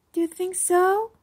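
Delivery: high, hopeful question; hopeful little girl. A narrator's reading. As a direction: ask it with a small hope. Iris asks hopefully.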